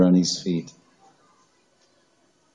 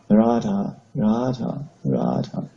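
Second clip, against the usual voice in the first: beseeching, imploring; slower than usual. Acted. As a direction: call her name like a sleeper begging in tears. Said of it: "very beseechingly and imploringly".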